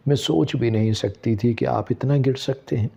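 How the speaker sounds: ordinary delivery, nothing marked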